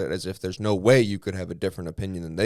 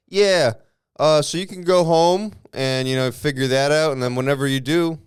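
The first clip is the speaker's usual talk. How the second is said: put-on loud, raised voice, as to an old customer; mock customer-service brush-off; 6 semitones higher, 8 dB louder than usual. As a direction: send him away loudly with fake helpfulness.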